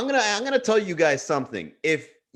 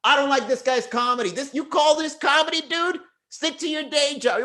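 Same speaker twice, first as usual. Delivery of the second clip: high, sneering commenter voice; mocking a hater; 9 semitones higher than usual. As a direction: read it like a snotty troll. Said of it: "People write it in the comments".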